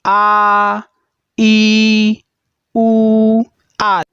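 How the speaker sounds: आ, ई, उ each held long and steady; slow classroom demo of long Hindi vowels; long vowels drawn out. Shown as a demonstration. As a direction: stretch every vowel like a teacher. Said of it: "दीर्घ स्वर कहलाते हैं, जैसे".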